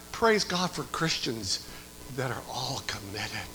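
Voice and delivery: a preacher turning quiet and earnest; soft praise. Acted. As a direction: bring the praise down low and slow. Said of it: "same praise, brought down".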